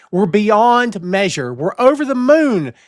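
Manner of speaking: building excitement. Acted. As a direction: pile it on, rising. Building gushing list.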